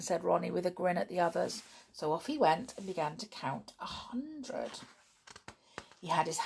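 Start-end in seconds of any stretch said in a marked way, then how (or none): none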